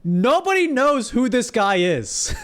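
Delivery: high, emphatic disbelief; worked-up exclamation. Real, not acted. Exclaims over surprising poll result.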